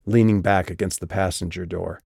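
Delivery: usual speaking voice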